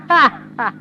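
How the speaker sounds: hammed-up taunting laugh; mocking laugh. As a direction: throw out a scornful ha-ha. Scornful reply to his doubt.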